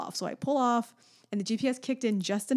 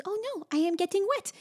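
put-on little girl voice; 10 semitones higher than usual. Toddler complaining in rain.

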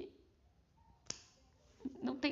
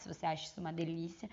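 usual speaking voice

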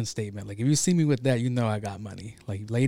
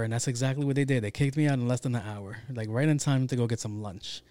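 ordinary delivery

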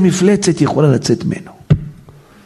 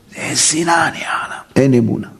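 hushed whisper; 3 dB quieter than usual. Real, not acted. Rabbi whispering to the congregation about hatred. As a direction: drop to a whisper. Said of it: "whispered aside".

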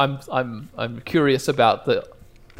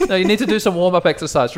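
ordinary talk, nothing marked

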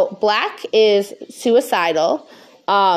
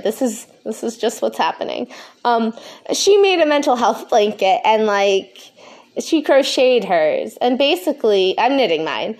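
usual speaking voice

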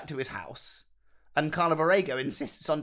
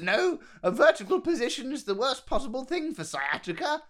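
high-pitched imitation of a protesting old man; lightly voiced old birdwatcher, pitched up; 10 semitones higher than usual. Fallen birdwatcher turning down a hand up, protesting old man.

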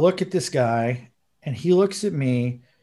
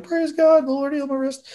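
joyful praise; 12 semitones higher than usual. Retold or imitated. Retelling the healed man's joyful praise, excited and high. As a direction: suddenly shoot up high, thrilled and amazed. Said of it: "And he was like".

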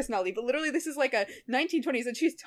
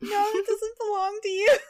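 much higher, whiny mock protest; mock jealous whine, louder and higher; mock upset; 11 semitones higher, 4 dB louder than usual. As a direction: possessive fan wail, hammed-up. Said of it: "I get, like, weirdly upset".

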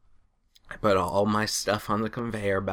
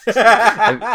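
high, loud, slowed words broken by laughter; laughing through words; 12 dB louder than usual. Real, not acted. Laughing while telling.

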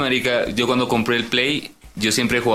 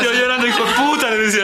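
high laughing mock lament; half-laughing, half-wailing the curse; 12 semitones higher than usual. Retelling cursing his brother after losing, laughing.

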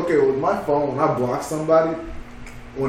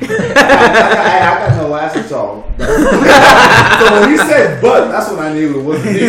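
louder, high, laughing through words; 5 semitones higher, 8 dB louder than usual. Real, not acted. Guy laughing at his own joke about his butt, loud. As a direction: talk while cracking up.

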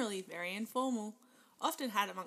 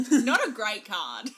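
laughing; 11 dB louder than usual. Real, not acted. Laughing over tarot card.

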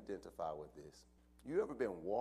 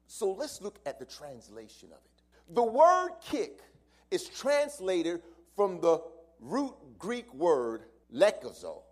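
higher, louder, slow preaching; preaching at full force; 8 semitones higher, 14 dB louder, slower than usual. Acted. Pastor explaining the Greek word to the church, loud preaching. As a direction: lean into each word and build.

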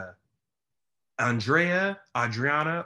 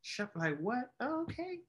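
much higher, softer and dazed; learning she sounds Southern; mock realization; 6 semitones higher, 9 dB quieter than usual. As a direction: act out a surprised discovery. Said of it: "And I was like".

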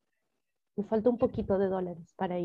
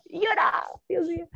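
high-pitched mock wail; dramatised tearful cry; acted crying; 7 semitones higher than usual. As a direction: imitate crying out, voice leaping high. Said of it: "yo lloraba".

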